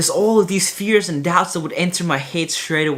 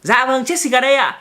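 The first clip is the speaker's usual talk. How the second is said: playing sister on phone; 9 semitones higher, 4 dB louder than usual. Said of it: "I'll play along that I'm Jessica".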